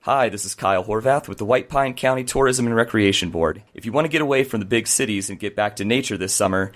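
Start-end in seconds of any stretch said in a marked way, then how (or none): none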